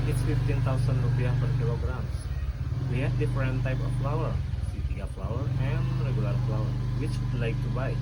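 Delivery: loud, fast price announcement; a seller calling prices to a customer; loud shopkeeper. Acted. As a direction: rattle off the prices loudly like a busy shop seller. Shopkeeper role-play, quoting prices.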